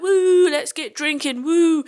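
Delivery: hammed-up "let's go out" whoop; mock party cheer. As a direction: whoop like you're starting a night out. Imagined party cheer at the concert.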